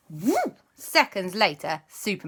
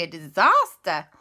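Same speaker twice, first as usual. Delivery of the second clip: plain reading voice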